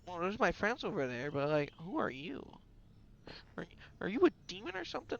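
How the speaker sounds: soft voice swooping up high; puzzled, pitch leaping on the questions; bewildered questioning. Acted. Wary character questions the puppet.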